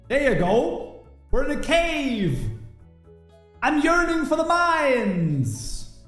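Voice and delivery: ordinary talk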